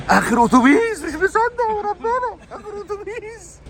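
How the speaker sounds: high, loud disbelieving cry; excited. Real, not acted. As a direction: shout it out in disbelief. Excited about last bus. (صرخة حماس)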